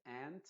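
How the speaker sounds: aunt pronounced like ant, A of cat; American aunt. Shown as a demonstration. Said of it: "pero en Estados Unidos se dice ant". ("ant" como en Estados Unidos, U silenciosa)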